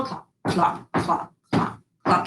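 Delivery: unvoiced clopping steps through mud; whispered clops. Shown as a demonstration. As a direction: tap out the footsteps in a hush. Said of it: "Can you walk through the mud puddle?".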